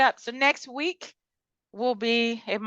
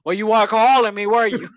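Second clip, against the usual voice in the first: louder, imitating someone dropping the baby voice; mock mad; 8 dB louder than usual. Imitating a reality star who got mad, mock angry. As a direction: hit it hard like a mock telling-off. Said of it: "drop the bass when they get mad".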